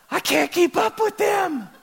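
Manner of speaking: high, drawn-out panicked cry; imitated terror. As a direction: cry out in mock desperation. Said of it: "look of sheer terror and fear".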